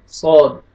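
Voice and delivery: सौद with a thick saad, not seen; सौद, emphatic s sound; heavy saad. Shown as a demonstration. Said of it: "सीन नहीं पढ़ना".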